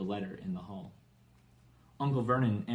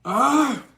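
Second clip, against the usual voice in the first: much higher, much louder startled cry; startled yell; 14 semitones higher, 14 dB louder than usual. A narrator's reading. Uncle stepped on in his sleeping bag, yelling. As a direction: yelp as if someone trod on your face.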